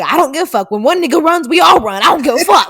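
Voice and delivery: hammed-up loud dismissal from the group; retold brash reply. Acting out strangers who ran too, brash shouting.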